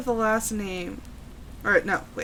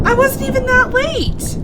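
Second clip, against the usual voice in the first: high, loud, outraged protest; indignant shout; 13 semitones higher, 9 dB louder than usual. Real, not acted. Startled player protesting at the game, loud and high. Indignant after jump scare.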